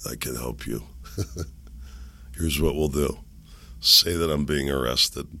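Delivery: low, lightly voiced mob boss; Godfather. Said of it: "he's like the Godfather".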